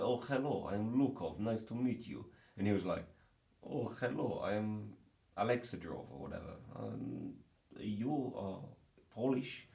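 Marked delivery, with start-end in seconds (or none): 0.0-2.3 high, loud mock Russian accent; hammed-up Russian introduction; Lukov. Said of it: "I was Lukov or Sergei". 3.6-6.1 imitated Eastern European accent, pitched up; put-on Eastern European greeting. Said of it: "he is Eastern European". 6.7-9.7 mock Eastern European accent, rising question; imitated Eastern European accent. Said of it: "he is Eastern European".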